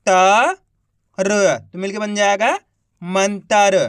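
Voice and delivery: loud, slow, each syllable of मंतर set apart, त and र punched; slow syllable-by-syllable spelling. Shown as a demonstration. Teacher sounding out मंतर for young readers, loud and slow. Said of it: "एक बार फिर से देखिए".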